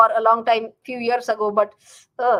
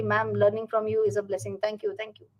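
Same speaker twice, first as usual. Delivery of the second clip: usual speaking voice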